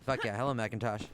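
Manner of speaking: ordinary talk, nothing marked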